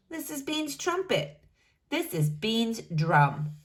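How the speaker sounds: plain reading voice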